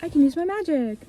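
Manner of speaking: slow, breathy gasp of realization; mock realization. Eve suddenly realizing she can use magic.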